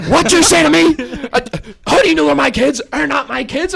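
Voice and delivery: very high, loud mock screech; mimicked furious woman. Woman runs up after teller's remark, outraged mock screech. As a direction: imitate her charging back outraged. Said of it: "after screaming at me".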